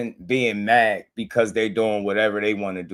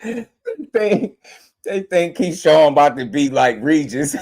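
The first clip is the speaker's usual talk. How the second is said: laughing; 5 semitones higher, 5 dB louder than usual. Real, not acted. Laughing at fans' predictions.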